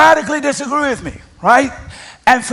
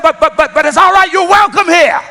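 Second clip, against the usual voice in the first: emphatic welcome; 6 semitones higher, 5 dB louder than usual. Retold or imitated. Auntie insisting on welcome.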